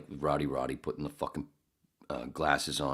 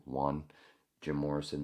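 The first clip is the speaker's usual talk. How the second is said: usual speaking voice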